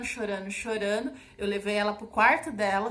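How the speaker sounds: ordinary talk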